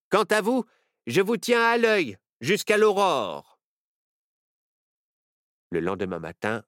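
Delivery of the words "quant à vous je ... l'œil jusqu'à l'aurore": higher, louder stern warning; sheriff's warning. Acted. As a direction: keep an eye on the children, firmly. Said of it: "Le shérif les regarda et leur dit". (le shérif avertit les enfants, sévère)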